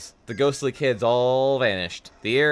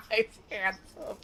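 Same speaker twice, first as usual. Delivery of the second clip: laughing; 8 semitones higher, 5 dB quieter than usual. Acted. Mocking handsome description.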